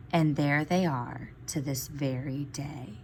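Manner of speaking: soft hushed storybook close; whispered ending. A narrator's reading. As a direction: whisper the last line like a secret.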